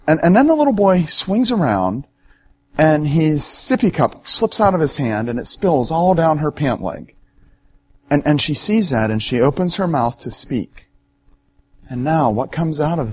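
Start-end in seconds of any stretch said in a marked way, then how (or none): none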